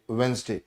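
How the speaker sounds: वेंसडे said as "Wensday", no middle d; showing students how to say Wednesday; correct pronunciation. Shown as a demonstration. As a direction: say वेंसडे in two syllables. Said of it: "क्या बोलते हैं?".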